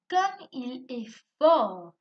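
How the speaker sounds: loud, slow exclamatory tone; exclamation. Shown as a demonstration. Teacher showing an exclamation, loud and slow. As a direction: exclaim it with feeling, drawn out. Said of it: "le ton n'est pas pareil".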